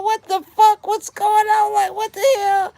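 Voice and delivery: retold jaw-drop outcry, high and loud; shocked disbelief. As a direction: yelp it out in total disbelief. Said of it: "I'm like".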